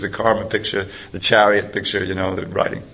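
ordinary delivery, nothing marked

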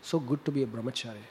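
a hushed aside of relief; private thought. Retold or imitated. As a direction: say it quietly to yourself. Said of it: "I was thinking".

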